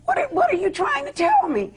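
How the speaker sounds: very high, incredulous demand; alarmed retelling. Retold or imitated. Mother retelling the call from her sobbing daughter, alarmed disbelief. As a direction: pitch soaring as the news sinks in. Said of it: "I was like".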